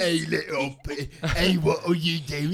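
a hint of Peaky Blinders; British voice. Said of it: "Peaky Blinders".

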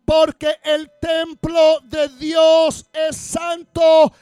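slow, loud shouted scripture; preaching at full force. Acted. Pastor reading Corinthians to the congregation, shouting. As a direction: thunder the verse at the church.

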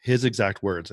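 ordinary talk

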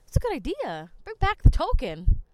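ordinary talk

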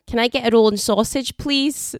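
put-on Glasgow accent; English speaker imitating a Glasgow accent; Govan accent. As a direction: order like a local from Govan. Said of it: "you actually sound like you're from Govan".

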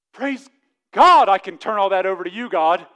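loud, high shout of praise; full-force praise. Real, not acted. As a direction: cry out surrender to God.

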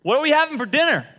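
calling out high and loud; husband calling to wife in the kitchen; husband hollering at his wife for dinner. Retold or imitated. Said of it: "he goes".

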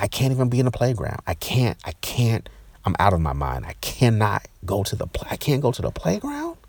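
high-pitched, exasperated outburst retold; mad. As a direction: break off and restart, beside yourself with outrage. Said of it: "and I'm mad".

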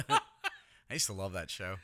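laughing. Real, not acted. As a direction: laugh while saying it. Laughing through sentence.

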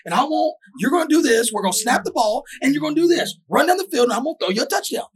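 high, hammed-up coach giving the play; squeaky impression of a coach drawing up a play; mock coach. Coach voiced in football story.

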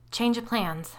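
high, shaky and a bit loud. Acted. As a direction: voice trembling with nerves. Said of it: "I said in a shaky voice".

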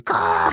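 low, loud mock seabird caw; low seagull caw. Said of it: "one of the seabirds going".

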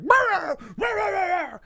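high, loud mock barking; imitating the dog barking. Imitating Fritz barking at night, loud high mock barks. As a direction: shout rah like a yapping dog. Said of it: "He's like".

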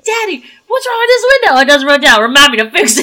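put-on high kid whine, then a loud dad line; embarrassing dad joke. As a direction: play kid and corny dad. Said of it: "classic dad joke when he says".

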